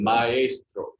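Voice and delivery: "Maestro" drawn out in Spanish, ma-ES-tro; Spanish model pronunciation. Shown as a demonstration. Teacher echoing the class, "Maestro" as ma-ES-tro. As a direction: repeat "Maestro" carefully, leaning on the middle. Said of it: "Can everyone say that?".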